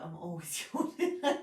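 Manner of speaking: a yawn breaking into a laugh; laughing while yawning. Real, not acted. Teacher apologizing to her class for yawning, laughing. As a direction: laugh through the word yawning.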